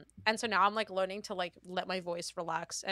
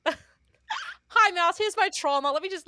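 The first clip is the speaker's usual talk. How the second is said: mock cheerful presenter; 10 semitones higher, 6 dB louder, slower than usual. Ironic cheerful trauma offering.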